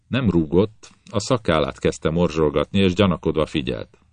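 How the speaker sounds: ordinary delivery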